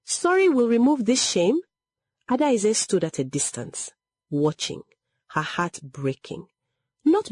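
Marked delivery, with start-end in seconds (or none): 0.0-1.6 high, indignant rising question; mildly shouted, outraged rebuke; shouted retort. A narrator's reading. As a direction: challenge the apology with raised pitch. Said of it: "Egodi shouted".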